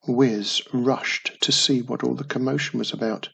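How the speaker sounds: plain reading voice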